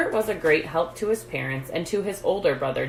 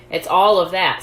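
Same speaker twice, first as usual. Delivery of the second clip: a trifle annoyed; 9 dB louder than usual. A narrator's reading. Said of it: "a trifle annoyed that his hook idea hadn't worked".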